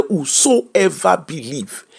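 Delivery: "Whosoever" drawn out slowly, weight on every syllable; stress on whosoever. Shown as a demonstration. Quoting scripture to listeners: slow, heavy stress on "Whosoever". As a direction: lean slowly on "Whosoever believe". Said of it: "Emphasis on whosoever".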